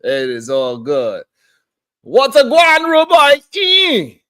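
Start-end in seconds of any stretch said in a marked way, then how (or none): 2.0-4.2 high, loud Caribbean patois greeting; Caribbean accent. Retold or imitated. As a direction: hail a rude boy in thick Caribbean patois. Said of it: "How's my accent, boy?".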